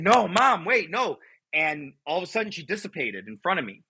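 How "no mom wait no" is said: high pleading cry, only half voiced; retold scream. Son begging his vanishing mother to stay, retold scream. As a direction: plead in a raised pitch without full volume. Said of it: "I'm screaming".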